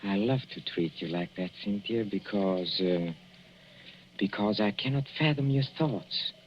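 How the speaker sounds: hushed, falsely tender; soft menace. Acted. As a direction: purr the words low and close. Husband's soft sinister tenderness.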